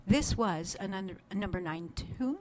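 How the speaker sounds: ordinary delivery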